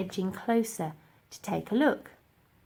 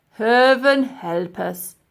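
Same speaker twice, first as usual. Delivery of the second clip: very slow, loud groan; exasperated professor; 3 semitones higher, 10 dB louder, much slower than usual. Acted. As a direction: despair of them.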